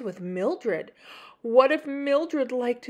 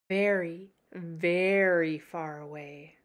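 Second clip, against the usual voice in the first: slow, lower far-off telling; slow, lower distant tone; drawn out; 4 semitones lower than usual. Acted. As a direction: stretch it out far away.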